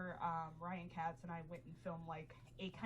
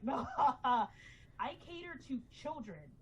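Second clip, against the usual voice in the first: laughing through a slow, louder retort; 6 semitones higher, 7 dB louder, slower than usual. Retold or imitated. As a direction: laugh it off while refusing, slowly. Retold retort, laugh heard.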